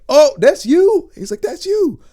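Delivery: high, loud amazed recognition; retold excited disbelief; Michael B. Jordan. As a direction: exclaim it in astonished delight, pitch way up. Acts out star's amazed recognition.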